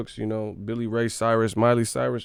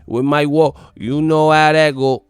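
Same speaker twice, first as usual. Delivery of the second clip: put-on loud, raised singsong Jamaican-tinged accent; mock Toronto-Jamaican accent; 5 semitones higher, 7 dB louder than usual. Fan mocks [name] claiming Toronto, Jamaican-tinged accent. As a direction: stretch it in a put-on Toronto patois. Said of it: "accent Jamaican".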